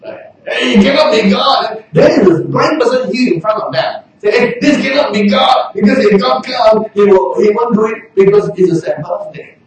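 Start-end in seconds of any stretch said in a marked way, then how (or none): 0.5-1.8 dismissive scoff. Retold or imitated. Preacher voicing Pharisees to congregation, indignant. 4.3-9.6 dismissive scoff. Retold or imitated. Preacher voicing Pharisees to congregation, indignant.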